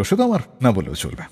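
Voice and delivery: ordinary talk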